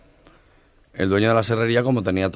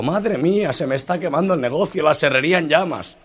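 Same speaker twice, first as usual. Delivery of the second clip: higher, alarmed cries; panicked owner; 7 semitones higher than usual. Retold or imitated. Owner seeing his sawmill burn, hammed-up panic. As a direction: sound frantic at the fire.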